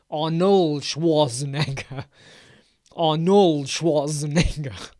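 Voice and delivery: slow "Arnold Schwarzenegger" with the R in "Arnold" rolled. Shown as a demonstration. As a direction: roll the R in "Arnold", slowly. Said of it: "when you say roll the R".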